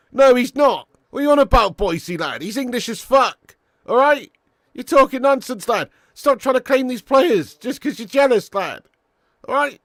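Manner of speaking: loud, high-pitched ranting; heated telling-off. Real, not acted. Streamer scolding a viewer for claiming players, loud and heated. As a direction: shout him down indignantly.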